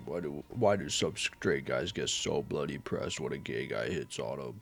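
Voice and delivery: low, soft just-woke-up mumble; lightly mock his own sleepy voice; groggy mumbling voice. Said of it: "That's what I sound like".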